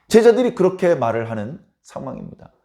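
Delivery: normal talking voice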